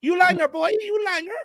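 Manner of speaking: high mock Cork accent, singsong on You Langer; a hammed-up Cork lad jeering You Langer, boy. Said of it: "That's how they speak, real high pitched".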